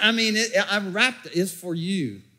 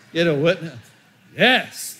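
ordinary talk

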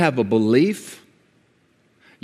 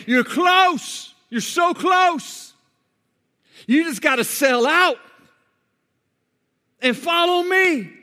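pleading shout; slower than usual. Acted. Jesus urging the rich man to follow, high pleading shout. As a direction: beg him to come the last step, high and strained. Preacher voicing Jesus' plea.

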